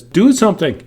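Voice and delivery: high, loud mock shout; demonstrated yell. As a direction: throw it out as a pretend command. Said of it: "You would yell".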